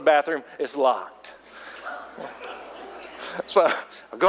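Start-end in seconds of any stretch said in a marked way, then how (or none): 1.8-2.5 silent laugh. Real, not acted. Bathroom locked, laughs.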